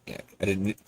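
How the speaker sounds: normal talking voice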